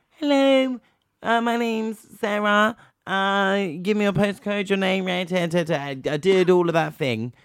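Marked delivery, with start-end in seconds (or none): 0.1-5.7 high, bright phone-operator greeting, slowed on the name; mock call-centre operator answering the phone. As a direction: put on the chirpy customer-service voice.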